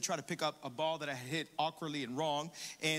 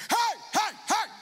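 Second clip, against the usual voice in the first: high, loud imitated yell of hey; mock shout; 8 dB louder, slower than usual. Golf ranger yelling at the pastor on the green, loud shout. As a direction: call out sharply like a golf ranger. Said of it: "all of a sudden I hear".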